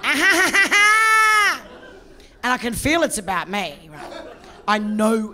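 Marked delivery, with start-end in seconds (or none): 0.0-1.6 high, loud mock cackle; mocking laughter. Masseuses laughing at the client, high mock cackle. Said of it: "they're laughing in Korean".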